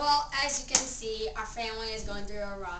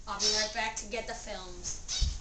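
ordinary delivery

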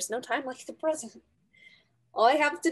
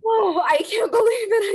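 high and loud with disbelief; overjoyed; 8 semitones higher, 6 dB louder than usual. Acted. Girl still reeling from her acceptance, high and loud. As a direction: still breathless with joy.

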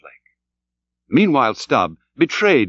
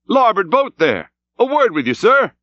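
high, raised boat hail; crying out; 9 semitones higher than usual. A narrator's reading. Stubb asks Starbuck for a word, calling out. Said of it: "cried Stubb".

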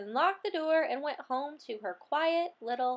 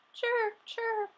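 cricket chirp; 9 semitones higher than usual. A narrator's reading. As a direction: chirp happily into the night. Happy cricket noise at night.

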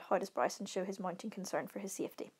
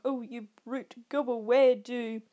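put-on mock-pleading tease; 2 semitones higher, 6 dB louder than usual. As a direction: tease with a theatrical wail, louder in the middle. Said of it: "He adopts this mocking tone".

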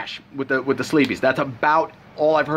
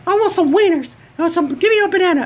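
high, louder falsetto for the wife's flirty ask; imitating the wife; 13 semitones higher, 3 dB louder than usual. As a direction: squeak it out as a coy wife teasing her husband. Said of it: "And she's like".

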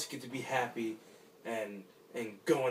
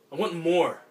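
loud, higher pleading yell; a raw cry for more; desperate shout; 5 semitones higher, 11 dB louder than usual. Acted. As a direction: shout it at the sky. Desperate man shouts his wish.

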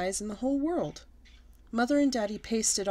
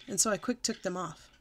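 ordinary delivery

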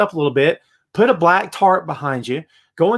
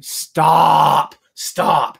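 loud, long-drawn "stop" on a level pitch; exasperated stop; 5 dB louder than usual. Real, not acted. Host interrupting the dull promo he mimicked, loud and exasperated. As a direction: cut it off, drawing out the vowel.

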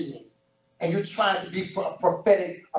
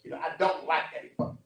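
ordinary talk

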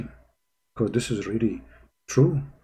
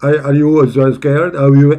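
loud mock repeat of his questions; mocking echo; 8 dB louder, faster than usual. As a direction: throw his questions back at him, louder. Mockingly echoes the played clip.

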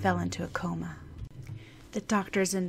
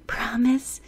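a touch of sad hope in a higher rising plea; sadly hopeful; 6 semitones higher, 8 dB louder than usual. Acted. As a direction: ask it hoping against hope. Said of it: "in a sadly hopeful voice".

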